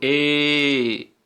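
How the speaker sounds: ए held as one pure vowel sound; vowel ए demo. Shown as a demonstration. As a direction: sound out ए loud and clear. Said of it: "अब बोल के देखो". (शुद्ध स्वर ए का उच्चारण)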